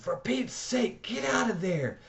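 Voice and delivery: put-on Dally screaming at the boy inside the burning church. A narrator's reading. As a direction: scream at him to get out now. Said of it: "he screamed".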